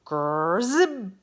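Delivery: loud buzzing growl of an arrow; hornet arrow buzz. A narrator's reading. Arrow tearing toward Bartholomew, buzzing growl. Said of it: "Like a mad giant hornet".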